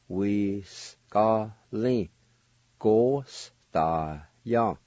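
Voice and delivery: "Da, wi, s, ga, lin" and "Go, s, da, ya" said as separate parts; teacher modelling a two-part word; syllable by syllable. Shown as a demonstration. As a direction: sound out each part, then join them. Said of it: "First part is pronounced like this".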